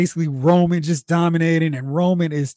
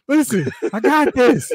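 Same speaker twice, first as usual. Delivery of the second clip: mock Solo; 10 semitones higher than usual. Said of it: "It's like".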